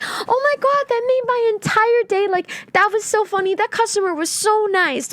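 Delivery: squealing high with delight over a joke; gush, voice jumping way up. Retold or imitated. Worker overjoyed at a customer's joke, squealing high delight. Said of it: "I'm like".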